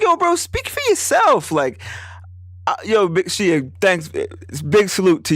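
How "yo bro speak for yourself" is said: high, slow, drawn-out brush-off; dismissive comeback. Retold or imitated. Telling his friend to speak for himself, slow high. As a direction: wave him off, slow and high. Retold reply to doubting friend.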